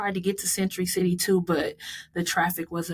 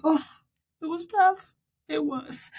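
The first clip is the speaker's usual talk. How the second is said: pained squeal; 10 semitones higher than usual. Real, not acted. Recalling the hardest miles, high pained squeal. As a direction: squeak it out like it hurt.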